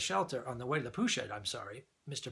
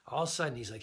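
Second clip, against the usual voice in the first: ordinary talk, nothing marked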